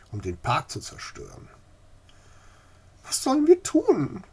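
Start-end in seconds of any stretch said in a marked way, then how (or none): 3.0-4.2 high, loud desperate plea; despairing old lady asks for help. Acted. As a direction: beg for a way out. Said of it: "fragte sie verzweifelt".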